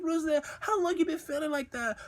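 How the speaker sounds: high-pitched girlfriend impression; imitated girlfriend. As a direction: ask it in a high imitation of her. Voicing the girlfriend on the call.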